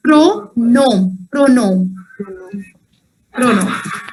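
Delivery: pronom in two slow syllables, silent m; French pronunciation of pronom. Shown as a demonstration. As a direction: model pronom as "pro-no". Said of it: "the pronunciation is".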